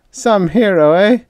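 high, loud self-mocking jab; a tight, bitter joke at himself. A narrator's reading. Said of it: "gave a tight little laugh".

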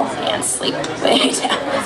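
whispered command to sleep; soft sleep command. Acted. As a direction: ease them down into trance. Hypnotist putting subject under.

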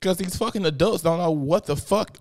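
ordinary delivery

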